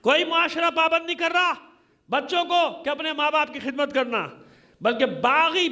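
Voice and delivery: ordinary talk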